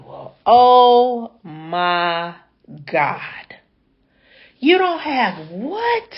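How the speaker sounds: drawn-out stunned oh my God, then a whispered God; shocked disbelief. Retold or imitated. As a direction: let it sink in, slowly stunned. Stunned reply to driver's confession.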